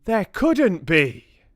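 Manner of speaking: high, loud, drawn-out protest; disbelieving shout. A narrator's reading. Witches answer one who smells something, high disbelieving shout. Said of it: "the others shouted".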